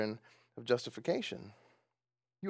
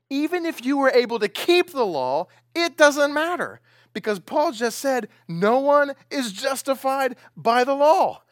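preaching at full force; 9 semitones higher, 8 dB louder than usual. Real, not acted. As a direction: thunder Luther's words at them. Preacher reading Luther at full force.

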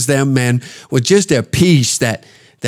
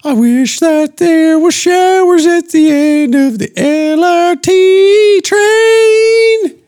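much higher, slow, put-on sing-song old man; mocking old veteran voice; 12 semitones higher, slower than usual. Two old men watching the trains, high slow sing-song mock reminiscence. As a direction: croon it like a doddering old soldier reminiscing. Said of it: "and think to ourselves".

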